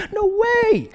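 very high, hammed-up squeal of disbelief; mock amazement. Friends see him on SportsCenter, hammed-up amazed squeal. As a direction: squeal it as if utterly astonished. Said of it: "I can't believe".

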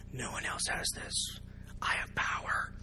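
put-on hushed, gloating whisper of a kid with a secret. Kid whispering over a juicy secret, gloating. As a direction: whisper it like forbidden power.

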